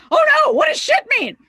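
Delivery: high, loud panicked cry; hammed-up alarm, shouted high; mock panic. Bandmates panicking in the van, loud mock panic. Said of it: "we're like".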